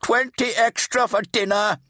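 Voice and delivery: high, loud shout to the kitchen. A narrator's reading. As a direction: bellow the order into the steamy kitchen. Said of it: "he shouted into the".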